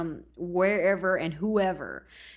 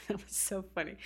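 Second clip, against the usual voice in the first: amused chuckle while talking; laughing; 9 dB quieter, faster than usual. Real, not acted. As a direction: laugh through the words.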